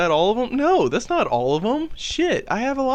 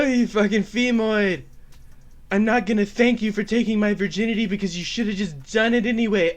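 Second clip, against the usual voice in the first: higher, peevish mock climax voice; Elliot Rodger; 4 semitones higher than usual. Comedian spoofs his nemesis losing virginity, Elliot Rodger impression. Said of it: "this is probably what it would have sounded like".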